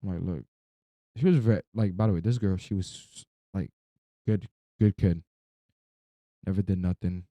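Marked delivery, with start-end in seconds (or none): none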